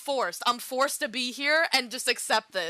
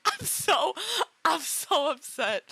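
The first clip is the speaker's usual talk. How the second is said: slow, teary, half-laughing through tears; real crying; 5 semitones higher, slower than usual. Real, not acted. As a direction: push the words out while really crying. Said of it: "I'm, like, literally crying".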